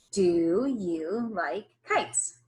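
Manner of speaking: "Do you like kites?" read slowly with the class; slow choral reading. Shown as a demonstration. Teacher leading children in slow choral reading. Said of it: "Read this sentence with me".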